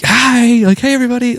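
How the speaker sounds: high, singsong hello to arriving guests; mock a cheery wave across the lot; imitated waving greeting. Magician waving, calling greetings.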